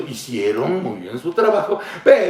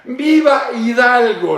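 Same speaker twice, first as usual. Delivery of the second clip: slow, higher ringing "¡Viva Hidalgo!"; mock shout; 8 semitones higher, 3 dB louder, slower than usual. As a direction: stretch it out like the president on the balcony. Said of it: "Entonces él decía".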